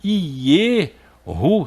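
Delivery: slow, drawn-out "Ieru" with an E; pronúncia demonstrada. Shown as a demonstration. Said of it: "Então seria Ieru". ("Ieru" lento e alongado, com E)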